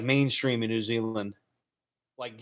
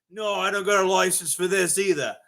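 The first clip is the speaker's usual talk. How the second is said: higher-pitched mock Australian accent; 6 semitones higher than usual. Outback man repeating his comeback, higher Australian accent. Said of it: "the guy living really in the outback".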